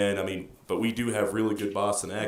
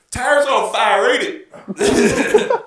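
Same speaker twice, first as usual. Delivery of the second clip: high, loud, drawn-out mock country holler; imitated Southern local; 13 semitones higher, 12 dB louder, much slower than usual. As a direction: holler it like a local in a beat-up truck. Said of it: "he's like".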